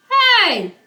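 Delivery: high, loud yell across the yard; yelling hey. Acted. Brother yelling to Lulu about the ball, loud call. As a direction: shout to get her attention. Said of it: "her brother yells".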